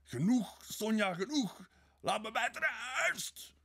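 high, loud, agonised shouting; pained scream. A narrator's reading. Tormented man begs Sonja to stop, screaming. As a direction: scream for her to stop, racked with pain. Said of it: "schreeuwde hij, verkrampt van smart".

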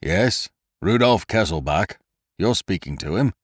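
put-on Lupin faking the prisoner's rough accent; imitating a rough accent. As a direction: put on the captive's rough voice on the phone. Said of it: "imitated the rough accent of his prisoner".

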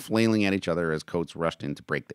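ordinary delivery, nothing marked

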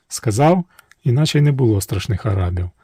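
ordinary delivery, nothing marked

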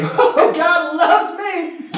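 high, slow, laughing mock delight; laughing mock joy. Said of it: "look at ... and say".

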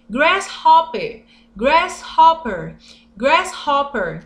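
grasshopper said slowly, three times; drawn out for learners to repeat; slow, clear model word; slow teaching. Shown as a demonstration. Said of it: "teaching vocabulary slowly".

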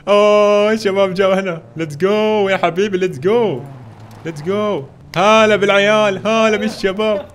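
slow, drawn-out excited greeting; hyped welcome. Real, not acted. Streamer greets arriving players with slow, hyped welcome. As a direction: stretch each word like a grand welcome.

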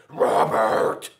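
sharp teacher's shout of the name; stern shout. Acted. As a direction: snap the name like an angry teacher. Teacher scolding in story.

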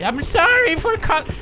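very high, hammy villain voice; exaggerated high movie-villain apology; Bane. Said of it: "stop with the Bane voice".

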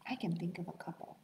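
soft playful whisper. Acted. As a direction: whisper it like a secret. Teacher whispers aside to children.

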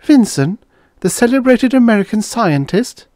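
very high, loud incredulous question; astonished recognition. A narrator's reading. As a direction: pitch it way up in amazement. Sits up, astonished at his identity.

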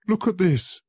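high, loud, anguished; desperate lawyer begging Holmes for help; desperate plea. Acted. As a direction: plead for help, panicking. Visitor with shaking hands.

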